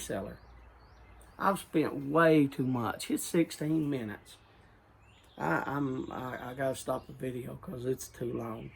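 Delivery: ordinary talk, nothing marked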